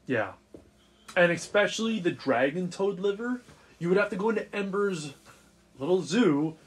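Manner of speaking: normal talking voice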